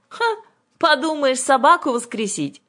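loud, high, scoffing witch; scornful snort. A narrator's reading. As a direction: scoff with a huffed "ha". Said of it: "фыркнула Ага".

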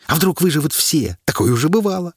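bookie's pitch. A narrator's reading. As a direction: tempt the crowd into a bet.

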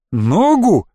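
sharp incredulous question; angry disbelief. A narrator's reading. Boy reacting to friend's injury, angry. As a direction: throw the word back crossly. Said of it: "Ещё больше разозлился я".

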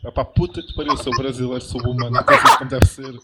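furious rant at a useless caller; angry rant. Real, not acted. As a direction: tell him off hard, cursing.

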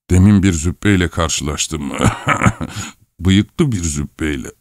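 acted old man breaking into coughs; old man's speech broken by coughing; coughing old husband. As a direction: talk on through a wheezy coughing fit. Old husband talks through coughing fits.

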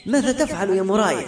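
sudden high cry at the cold water; startled cry. A narrator's reading. Yusuf cries out at Moray after cold water drenches him, a high startled cry. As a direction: yelp it out, caught off guard. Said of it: "وصحت قائلا".

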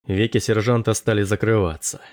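ordinary delivery

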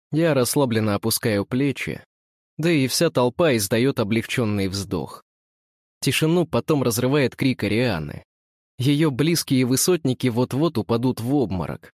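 ordinary delivery

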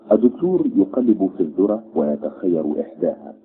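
usual speaking voice

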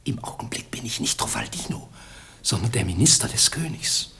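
hushed, voiceless whisper; secretive whisper. Acted. Minister sneaking up on the sleeping prince, whispering. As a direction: hiss for silence and keep it secret.

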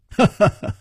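short, high ha-ha laugh; hearty laugh. Acted. Chu jokes with Nangong, short high laugh. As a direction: burst of high, joking laughter. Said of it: "楚留香哈哈一笑".

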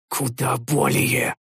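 slow, soft, strained reply; the hero replies faintly under great strain, slow and soft; barely audible. Acted. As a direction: answer almost inaudibly. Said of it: "едва слышно отозвался я".

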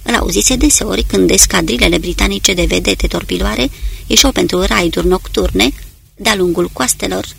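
ordinary delivery

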